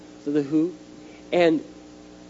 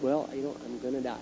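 much softer, resigned aside; low-volume private realization; resigned inner thought; 3 semitones lower, 9 dB quieter than usual. Retold or imitated. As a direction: think it quietly to yourself.

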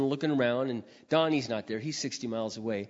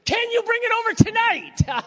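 very high, loud excited blurt; eager shout; 10 dB louder than usual. Retold or imitated. A man without a car shouting back to the woman offering hers, overjoyed. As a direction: jump at the offer with a yell.

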